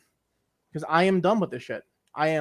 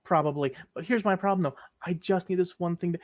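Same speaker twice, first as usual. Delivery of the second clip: usual speaking voice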